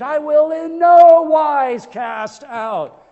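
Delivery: loud, high, slow, with NO stretched out; emphatic proclamation. Acted. Preacher proclaiming Christ's promise from King James, emphatic proclamation. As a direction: belt out the promise, holding "no".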